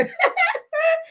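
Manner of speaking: shrieking laugh; high squealing burst of laughter; real high-pitched laugh. Real, not acted. Laughing at the name.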